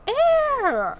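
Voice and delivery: high, loud "ew"; disgusted cry. Real, not acted. Disgust at plunging a toilet.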